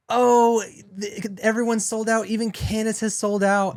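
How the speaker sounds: high, loud, gaslighting little voice; little mocking voice. Mocking an influencer who gaslights her fans, little voice. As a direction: put on a high, wailing little voice. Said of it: "with this little voice of".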